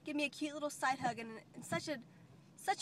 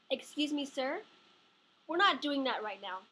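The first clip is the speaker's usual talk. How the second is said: ordinary delivery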